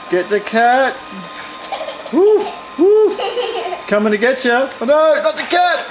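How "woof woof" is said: high, punchy pretend woofs; dog bark imitation. Person playing the dog to tease the cat, high barking.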